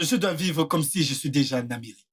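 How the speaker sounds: ordinary talk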